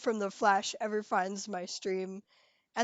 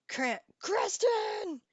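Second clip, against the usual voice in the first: high, slow, drawn-out vaguely Eastern European accent; Grant Creston accent; 9 semitones higher, much slower than usual. Shown as a demonstration. Streamer showing her Grant Creston accent, slow and high. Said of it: "I can do a Grant Creston accent".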